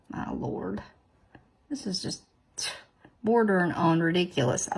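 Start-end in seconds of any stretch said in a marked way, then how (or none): 0.1-2.2 1 breathy aside. Real, not acted. Exasperated under breath.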